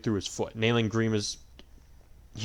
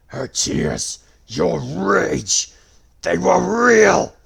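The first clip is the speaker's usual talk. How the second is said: snarling; 8 semitones higher, 12 dB louder, much slower than usual. A narrator's reading. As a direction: snarl it, rising to a roar. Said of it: "Grima snarled".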